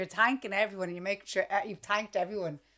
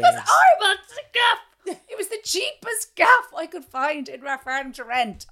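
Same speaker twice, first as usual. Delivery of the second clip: much higher and louder; exasperated outburst; 9 semitones higher, 13 dB louder than usual. Real, not acted. Telling co-host about the cheap rental, high and loud. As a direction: burst out about the awful flat.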